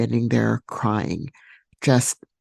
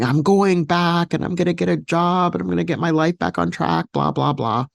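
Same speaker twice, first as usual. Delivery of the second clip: determined mock vow, pitched higher; 6 semitones higher than usual. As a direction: swear it like a stubborn promise.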